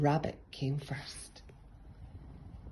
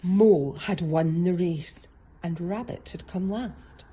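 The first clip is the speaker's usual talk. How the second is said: plain reading voice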